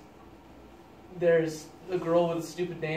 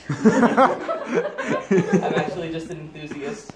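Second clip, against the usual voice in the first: slow, louder, said through laughter; laughing quote; 7 dB louder, much slower than usual. Retold or imitated. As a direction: deliver it slowly while cracking up.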